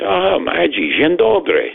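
high, bright "dzień dobry" said the Polish way; cheerful Polish greeting. Retold or imitated. Greeting the Polish bank teller, high and cheerful.

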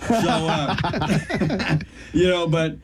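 words broken by real laughter; laughing. Real, not acted. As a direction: keep laughing through the filler. Still laughing at the joke.

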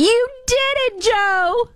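very high, slow, gushing cheer; imitating Jill Biden. Host mimicking Jill praising Joe after debate, high squeal. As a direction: squeal it like a proud wife. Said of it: "couldn't say enough good things about Joe".

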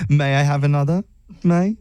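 put-on British accent, punning lightly; bad British accent. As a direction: joke in a fake British accent. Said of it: "do my absolute worst British accent".

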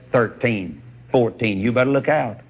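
ordinary talk, nothing marked

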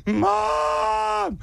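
high, wailed cry for Mom; hammed-up child's wail; mock crying kid. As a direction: imitate a kid bawling for his mother. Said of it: "Stop crying, stop crying".

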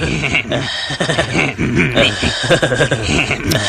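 sustained run of laughter; long laugh. Real, not acted. Host reacting to Sean's beef, laughing at length. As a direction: laugh on and on at the joke.

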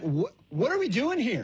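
high-pitched accusatory demand. Acted. Stepdad calling out the household, accusatory. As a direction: accuse them like a fed-up stepdad. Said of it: "it could be accusatory".

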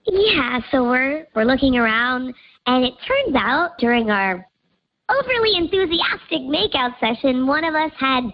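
digitally disguised voice; pitch-shifted anonymous voice; voice changer. Real, not acted. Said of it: "'cause Voice Changer's on".

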